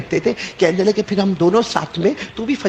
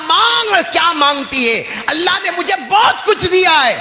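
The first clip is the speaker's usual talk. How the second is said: higher and louder, laughing with joy; loving overjoyed offer; 10 semitones higher, 4 dB louder than usual. Retold or imitated. Husband joyfully tells wife to ask anything, loud and laughing. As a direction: shout out the offer in happy love. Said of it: "اتنے پیار سے کہا".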